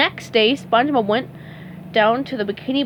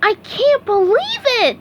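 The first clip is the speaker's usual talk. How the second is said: high, louder joyful squeal; excited cry; 12 semitones higher, 4 dB louder than usual. A narrator's reading. SpongeBob signing up, joyful high cry. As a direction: burst out with joy. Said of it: "SpongeBob cried".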